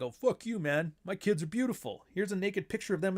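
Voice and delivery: mock huffy dad; defensive new dad. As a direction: bristle like an offended new father. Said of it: "a new dad, and they're like".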